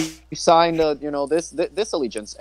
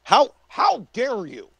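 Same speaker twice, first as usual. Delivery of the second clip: mock indignation; 5 semitones higher than usual. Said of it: "yell at Michael Cohen a little bit".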